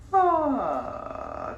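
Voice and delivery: high head voice sighing down into fry; creaky vocal fry warmup. Shown as a demonstration. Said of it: "just kinda sigh into it".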